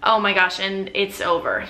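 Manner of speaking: normal talking voice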